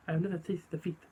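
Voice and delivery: quiet, measured boxer voice; Chris Eubank impression. Impressionist playing a boxer for his brother, quiet impression. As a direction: put on a boxer's soft, deliberate speech. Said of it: "Chris Eubank, right?".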